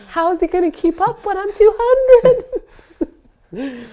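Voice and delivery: joking about her weight, laughing high and loud. Real, not acted. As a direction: squeal it through laughter.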